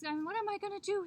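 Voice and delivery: high, rushed panic; worried Coyote. A narrator's reading. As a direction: fret out loud, fast and loud. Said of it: "he thought".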